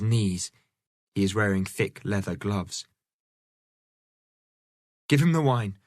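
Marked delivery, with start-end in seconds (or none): 5.1-5.8 firm higher command; ordering. Acted. Catherine ordering the friar, firm command. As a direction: order it plainly and firmly. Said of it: "she orders the friar".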